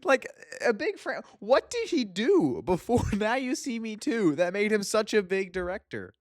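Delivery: high-pitched baffled protest; incredulous laughing rant. Real, not acted. Host asking what the director did before, incredulous. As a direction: demand an answer, half laughing.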